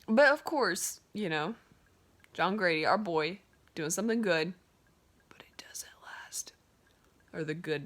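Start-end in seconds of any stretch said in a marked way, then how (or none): 5.3-6.5 whispered aside, slowed down; dramatic whisper. Real, not acted. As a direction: let the bad news slip out in a slow whisper.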